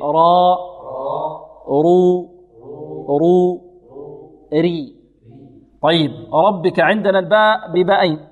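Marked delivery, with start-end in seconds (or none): 0.0-4.9 "راء" and "رو" with heavy r, "ري" with light r; slow model syllables "راء، رو، ري", r thickened except before i; letter drill. Shown as a demonstration. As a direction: sustain each syllable of "راء، رو، ري" for repetition, lips unrounded on "راء". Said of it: "بدون ضم شفتين".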